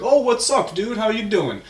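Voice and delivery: high, loud mock-friendly hail; excited greeting. Acting Italians greeting Shrek.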